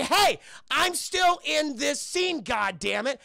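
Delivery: hammed-up indignant yell; mock shout. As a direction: drag it out like an ignored sidekick. [name] objecting, left out.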